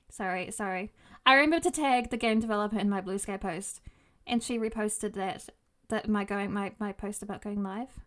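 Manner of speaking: normal talking voice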